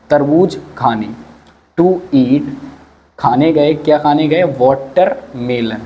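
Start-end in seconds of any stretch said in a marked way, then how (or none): 4.4-5.8 slow, drawn-out dictation of watermelon; slow teacher dictation; slow spelled-out word. Shown as a demonstration. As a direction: say watermelon slowly, syllable by syllable, for the class. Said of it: "watermelon का मतलब होता है तरबूज".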